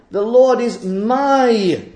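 slow, with "my" weighted heavily; stress on my. Shown as a demonstration. Preacher showing the personal claim to the congregation, slow stress on "my". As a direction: lean on "my" as if capitalized. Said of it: "capital my".

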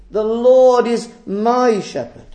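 ordinary talk

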